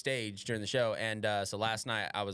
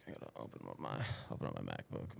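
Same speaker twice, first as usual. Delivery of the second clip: muttered aside; 10 dB quieter, faster than usual. Real, not acted.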